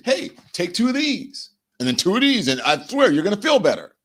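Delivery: hammed-up quack promising relief; mock pushy pill seller. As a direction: sell the fake cure with a wink.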